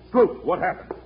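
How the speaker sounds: loud startled shout; alarmed. Acted. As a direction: cry out in sudden alarm. Villain caught, alarmed outburst.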